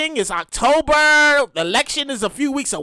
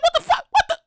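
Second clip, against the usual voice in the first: high, shocked disbelief; shocked outburst; 12 semitones higher than usual. Retold or imitated. Worker shocked at maskless relief, high outburst. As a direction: react to the coworker without a mask. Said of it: "I was like".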